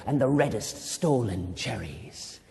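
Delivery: conspiratorial whisper; secretive whispered line. Acted. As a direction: hush it like a secret. Whispering secret of stolen cherries.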